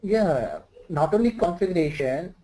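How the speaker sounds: ordinary talk, nothing marked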